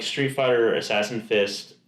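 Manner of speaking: ordinary talk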